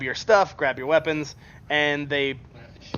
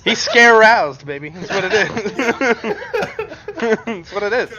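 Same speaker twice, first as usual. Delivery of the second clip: slow words broken up by laughs; cracking up mid-sentence; laughing; 6 semitones higher, 7 dB louder than usual. Real, not acted. GM laughing at the player's joke.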